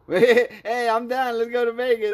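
loud, high hyped up; pumped and loud; excited. Real, not acted. Hyped about Vegas trip.

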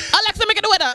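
aunt demanding the weather; aunt's accent. Retold or imitated. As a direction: call out to Alexa in a thick accent. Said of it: "within a reasonable time".

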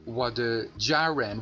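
ordinary talk, nothing marked

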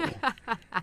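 high, breathy little giggle; tittering giggle. A narrator's reading. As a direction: giggle coyly. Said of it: "with a tittering giggle".

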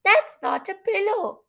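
high nasal character voice; high, nasal correction; Shivereen. Acted. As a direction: pitch it up high through the nose. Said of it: "trying to create her voice as nasal".

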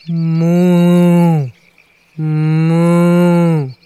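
loud drawn-out moo; long comic moo; butterfly mooing. Acted. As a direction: moo like a cow. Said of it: "exclamó".

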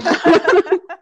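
loud, high burst of laughter. Real, not acted. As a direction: crack up loudly. (lautes Lachen)

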